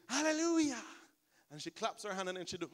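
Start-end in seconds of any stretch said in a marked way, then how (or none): 0.1-0.8 high, ringing hallelujah shout; ecstatic cry. Retold or imitated. Pastor imitating Lacey's cry during worship, ecstatic.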